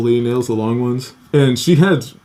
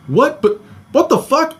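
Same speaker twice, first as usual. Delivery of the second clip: imitated high-pitched incredulous protest; mock outraged sputter, much higher; outraged disbelief; 12 semitones higher than usual. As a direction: protest the ban, voice shooting up. Banned man protests, about to curse.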